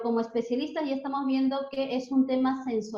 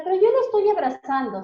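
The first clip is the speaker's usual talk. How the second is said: imitating a child's protest; 7 semitones higher, 4 dB louder, faster than usual.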